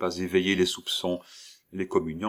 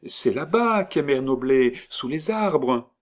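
put-on higher, louder child, slowing at the end; a child insisting, drawing it out; little girl; 7 semitones higher, 5 dB louder than usual. Girl points to Mère Noblet, higher child voice.

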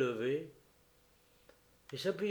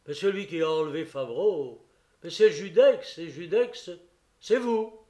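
crying out; 4 semitones higher, 10 dB louder than usual. Acted. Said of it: "s'écria".

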